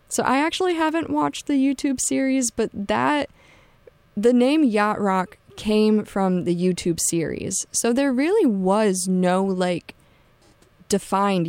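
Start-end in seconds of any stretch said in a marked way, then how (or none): none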